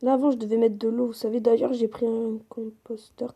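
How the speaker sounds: normal talking voice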